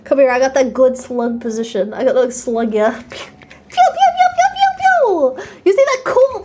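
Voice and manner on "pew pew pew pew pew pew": playful laser noises, high and drawn out; childish high pew-pew sound effects. Pretend. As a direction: fire off squeaky pretend lasers.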